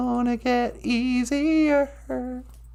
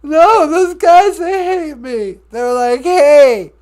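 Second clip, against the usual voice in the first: fake crying; 5 dB louder than usual. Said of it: "Don't cry".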